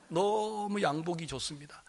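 hushed polite gushing, first word stretched; soft admiration. Retold or imitated. Preacher complimenting the pastor's suit, softly admiring.

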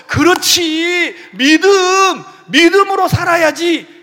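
high, slow, drawn-out eager assent; eager agreement. Retold or imitated. Believer responding to the sermon, eager drawn-out agreement. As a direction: agree with relish, stretching the first word. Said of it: "이렇게 막 여러분 마음이 일어나지요".

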